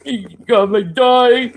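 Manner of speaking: high loud yell; hammed-up outraged yell; mock shouted quote. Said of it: "he's crying like a little boy".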